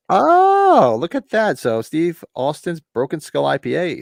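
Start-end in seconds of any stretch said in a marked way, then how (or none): 0.1-1.6 long, high, loud "oh"; drawn-out mock-amazed exclamation; retold delighted surprise. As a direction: re-enact spotting a great find. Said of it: "I was like".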